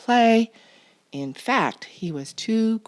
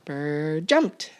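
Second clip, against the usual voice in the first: ordinary delivery, nothing marked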